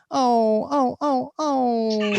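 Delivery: high, drawn-out mournful honks; sad air horn. Retold or imitated. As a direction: imitate a deflating horn with repeated wails. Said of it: "it sounded like a sad air horn".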